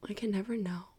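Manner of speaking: breathy whispered aside. Real, not acted. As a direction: drop to a whisper.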